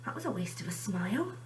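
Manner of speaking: disappointed little whisper to himself. Acted. Benny whispers his disappointment to himself. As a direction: breathe it out, let down.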